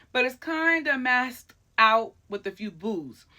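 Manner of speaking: ordinary delivery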